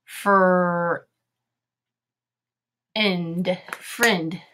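stretched Fr-, pause, ind, then frind; sounding out. Shown as a demonstration. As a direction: sound out friend as frind, short I. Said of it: "Here we go".

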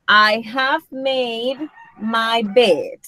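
very slow English example, words drawn out; teacher's slow, deliberate demonstration; slow model sentence. Shown as a demonstration. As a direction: stretch each word out for the students.

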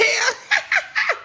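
high burst of laughter. Real, not acted. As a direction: laugh at your own corniness. Laughing at being corny.

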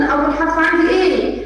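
usual speaking voice

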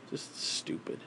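whispered under the breath; whispered disgust. Real, not acted. Man giving up on science, whispered scorn. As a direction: spit it out in a whisper.

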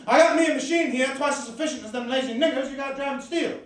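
haughty machine owner's pitch; high-and-mighty boss. Acted. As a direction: pitch it up, bragging to the captain. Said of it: "Mister George, he called himself, sitting high and mighty".

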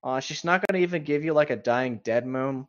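normal talking voice

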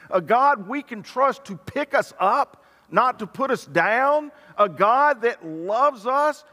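high, wondering amazement at good news; marvel, rising to a questioning end; amazed disbelief. Acted. New Corinthian Christians hearing of a merciful God, amazed disbelief. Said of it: "they just couldn't believe it".

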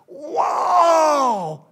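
high loud ecstatic shout; high loud joyful cry; ecstatic wow. Acted. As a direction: explode with joy. Exclaims at fortune.

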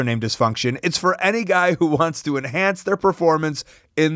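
upbeat sponsor read, selling it. Acted. Host reads a sponsor ad to listeners, upbeat ad read. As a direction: pitch the product like a host's ad spot.

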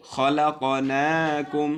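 very slow, drawn-out chanted word; tajweed chanting, loud and slow; Quran recitation. Shown as a demonstration. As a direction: stretch খলাকনাকুম slowly in recitation tune. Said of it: "এখানে নুন খাড়া জবর হবে".

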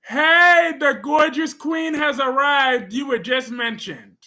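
high, loud greeting call; excited shout. Real, not acted. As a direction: shout a big hello to a favourite viewer. Greeting the viewer again.

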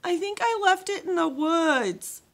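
high, loud wail, slow. A narrator's reading. Girl to the camp leader about her lost bottle, loud wail. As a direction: wail it like a kid who lost something. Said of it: "wailed Leonie".

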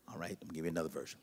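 ordinary talk, nothing marked

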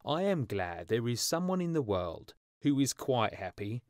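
ordinary delivery

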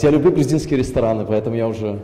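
normal talking voice

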